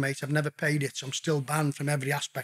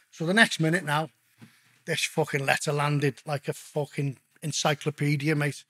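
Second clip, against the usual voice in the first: normal talking voice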